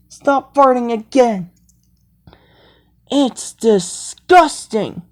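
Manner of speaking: ordinary talk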